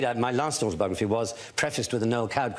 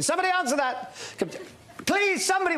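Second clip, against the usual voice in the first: shouting for someone. Retold or imitated. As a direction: yell up to the household to get the phone. Calling out to house guests.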